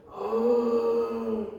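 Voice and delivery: long drawn-out, sleepy "uhh" on waking; groggy waking groan. Shown as a demonstration. Teacher showing students how a real sleeper wakes, groggy drawn-out groan. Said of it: "이렇게 일어나면 이건 잔 거야".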